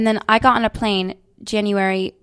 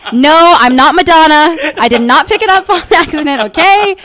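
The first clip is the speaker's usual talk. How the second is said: loud, higher, playful indignation; mock defensive protest; 6 semitones higher, 9 dB louder than usual. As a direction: protest loudly, half laughing. Mock-defensive about British accent.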